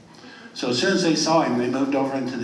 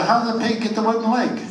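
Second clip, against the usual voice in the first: curious raised question; 9 semitones higher, 4 dB louder than usual. Acted.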